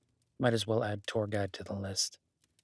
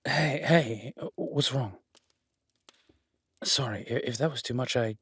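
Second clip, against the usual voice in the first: alarmed concern; 4 semitones higher, 6 dB louder than usual. Acted. Guest suddenly panics.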